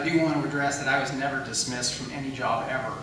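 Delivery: ordinary delivery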